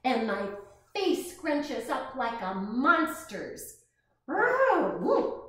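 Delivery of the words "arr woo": loud high roar then whoop; playful monster noises; monster growls. Acted. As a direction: growl to scare the kids. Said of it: "I make fierce noises".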